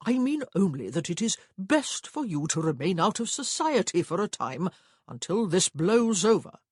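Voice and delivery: loud, stern brotherly warning. A narrator's reading. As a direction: lay it down sternly and loudly. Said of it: "he said sternly".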